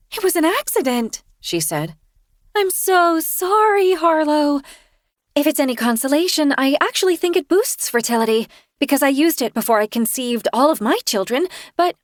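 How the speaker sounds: high, sheepish confession voice; Kirsten sheepish. A narrator's reading. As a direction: voice her high and apologetic. Kirsten apologizing.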